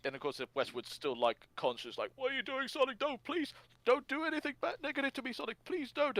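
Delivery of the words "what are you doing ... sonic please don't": very high squeaky begging voice; mock panicked pleading. Host as the victim begging Sonic, hammed-up panic. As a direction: beg frantically, far up in pitch.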